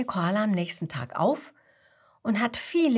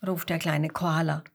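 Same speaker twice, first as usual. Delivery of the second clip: ordinary delivery, nothing marked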